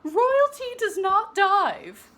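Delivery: slow, high, loud prim rebuke; stern teacher. Acted. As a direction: stress on "not", very proper. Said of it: "called her teacher".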